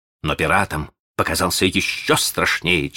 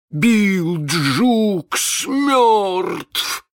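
very slow, high, stunned cry; amazed cry; 13 semitones higher, 3 dB louder, much slower than usual. Acted. The pirates cry out in amazement at the news. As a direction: cry out in disbelief. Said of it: "вскричали изумлённые пираты".

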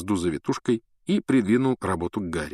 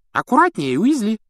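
high, loud reprimand; stern teacher; 14 semitones higher, 6 dB louder than usual. A narrator's reading. Профессор Стебль одёргивает Уизли, строго. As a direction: scold the clumsy pupil. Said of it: "сказала профессор Стебль".

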